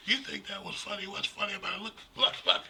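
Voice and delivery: high, fast mock girlfriend voice, half-whispered; offended girlfriend. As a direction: squeak it out like an indignant girlfriend. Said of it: "she's like".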